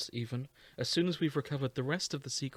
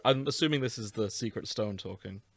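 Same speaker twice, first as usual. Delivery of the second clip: ordinary talk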